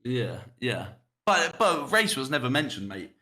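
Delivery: usual speaking voice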